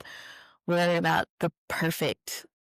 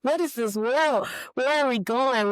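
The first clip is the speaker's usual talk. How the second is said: laughing disbelief; 9 semitones higher than usual. Retold or imitated. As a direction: retell the moment you lost it, half laughing. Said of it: "I just started laughing hysterically".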